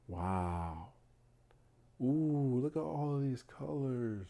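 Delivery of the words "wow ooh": normal talking voice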